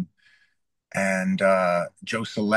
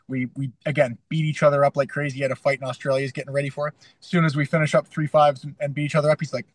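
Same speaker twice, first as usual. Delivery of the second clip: ordinary talk, nothing marked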